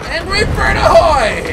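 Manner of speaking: high, loud, drawn-out hail; triumphant battle cry. Pretend. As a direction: bellow it as you let fly. Releases the charged shot.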